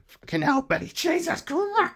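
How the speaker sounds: high-pitched frantic squeal; hammed-up terrified woman; mock panic. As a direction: shriek it like a fleeing victim. Acting Tanya racing out in panic.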